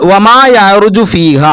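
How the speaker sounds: slow recited verse; Quran recitation. Shown as a demonstration.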